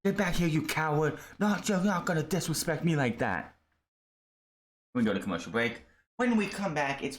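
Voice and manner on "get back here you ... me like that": mock angry yell. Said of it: "He's like".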